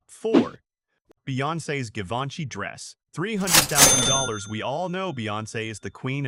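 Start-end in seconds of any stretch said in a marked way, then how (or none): 0.1-0.6 loud countdown call; announcing loudly. Real, not acted. Countdown number called out. 3.1-4.5 loud announcing. Real, not acted. Price announced.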